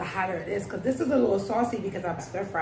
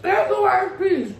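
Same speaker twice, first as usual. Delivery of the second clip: high, slow, loud exclaim; surprised high; 11 semitones higher, 5 dB louder than usual. Real, not acted. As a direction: squeal it out in shock. Reacting to spicy bite.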